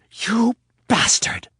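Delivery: high, loud, slow cry; furious outburst. Acted. Anna slapping Rapp and crying out in fury. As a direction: hurl the insult after the slap.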